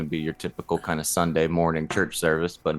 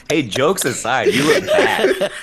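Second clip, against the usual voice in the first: laughing through the words, higher; 9 semitones higher, 6 dB louder, slower than usual. Real, not acted. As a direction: giggle while you tease him. Teasing, laughing.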